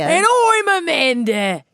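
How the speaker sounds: East End sailor voice; cockney accent. Acted. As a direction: say it like a London docker. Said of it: "Amanda is an East End sailor".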